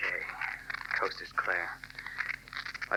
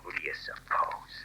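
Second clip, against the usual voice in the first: slow, hushed sarcastic whisper; crook's hissed whisper; 3 semitones lower, 5 dB quieter than usual. Acted. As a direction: keep it down so he won't hear. Crooks hiding at night.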